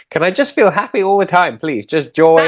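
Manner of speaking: high-pitched mock begging; playful pleading. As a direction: beg for it like a hopeful child. Playfully voicing a plea for happiness.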